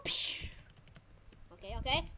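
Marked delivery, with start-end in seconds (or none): 0.0-0.5 whispery whooshing "pew"; imitated blast noise. Player voicing his own attack, blast noise. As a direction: make a voiceless shot sound.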